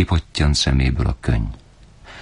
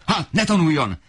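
furious father. A narrator's reading. Father keeps yelling at mother.

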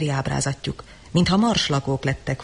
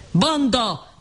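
sharp, higher shouted cry; roared insult; 6 semitones higher than usual. A narrator's reading. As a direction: bark it at the mob. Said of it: "ordította".

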